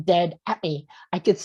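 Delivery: usual speaking voice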